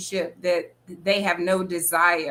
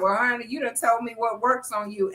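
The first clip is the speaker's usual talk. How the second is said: usual speaking voice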